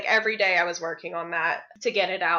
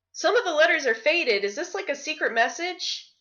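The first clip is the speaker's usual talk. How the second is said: curious reader; 8 semitones higher than usual. Retold or imitated. Commenters quoted, curious.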